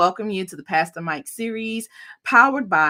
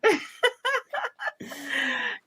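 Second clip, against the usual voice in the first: delighted laugh; 13 semitones higher, 4 dB quieter than usual. Real, not acted. Delighted laugh after intro.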